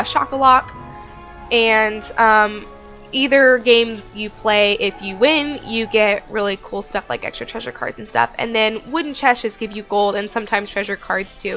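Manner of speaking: ordinary delivery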